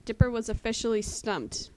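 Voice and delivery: ordinary delivery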